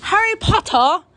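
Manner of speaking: high, loud British Harry Potter; Harry Potter in a British accent. Retold or imitated. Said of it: "a British accent when they say".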